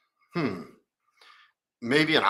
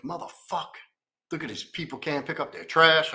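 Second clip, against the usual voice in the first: pissed-off grumble; 5 semitones higher than usual. Retold or imitated. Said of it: "I'm pissed off at the world".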